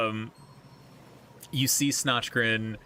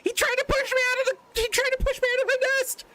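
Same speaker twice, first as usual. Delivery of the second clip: very high, fast, upset complaint; ghost child whining; 3 dB louder, much faster than usual. Acted. The ghost boy tells Kai who pushed him, high and upset. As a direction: blurt it out like a hurt little kid.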